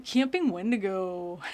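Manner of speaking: mocking, stretched-out list-narrator voice; mimicking a list narrator. Imitating that annoying video guy for viewers, mock narrator voice. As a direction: draw out the words like that irritating host. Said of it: "there's that one guy that goes".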